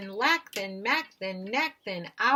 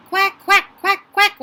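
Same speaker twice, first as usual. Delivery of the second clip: high, very loud quacking; loud duckling quacks; 11 semitones higher, 14 dB louder than usual. A narrator's reading. Frightened ducklings quacking at the cars, loud high quacks. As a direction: quack as loud as you can. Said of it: "just as loud as their, uh, quackers could quack".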